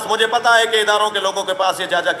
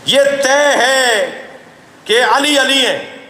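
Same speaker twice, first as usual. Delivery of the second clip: drawn out, each word weighed and raised; slow emphatic declaration; 5 semitones higher, much slower than usual. Real, not acted. Preacher declaring to the gathering, slow and emphatic. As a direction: declare it slowly, as settled truth.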